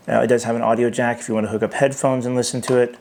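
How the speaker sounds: ordinary talk, nothing marked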